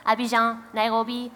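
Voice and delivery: usual speaking voice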